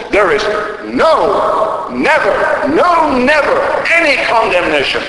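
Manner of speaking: loud, high preacher's shout; full-force pulpit cry; emphatic proclamation. Retold or imitated. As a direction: thunder out each "no" and "never" at high pitch.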